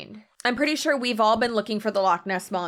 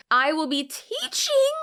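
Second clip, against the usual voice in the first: "teaching" said higher, punched as if in caps; mock bold caps reading; 6 semitones higher than usual. Reading a caption in bold caps, punched emphasis. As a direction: read "teaching" like a shouty all-caps caption. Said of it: "when it's in bold and in caps".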